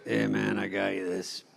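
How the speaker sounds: lightly voiced low tough guy; tough older brother. Brother handing over a gift, low tough-guy imitation.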